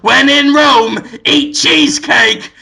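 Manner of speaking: theatrical, drawn-out movie-star declaration; Willem Dafoe. Acted. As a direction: deliver it like a grand punchline. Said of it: "and says".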